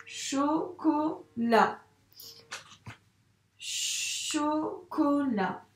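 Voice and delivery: chocolat, chaque syllabe tirée longuement; étire le mot en le relisant; relecture très lente. Shown as a demonstration. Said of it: "j'essaie de me relire". (maîtresse relit lentement, syllabe par syllabe)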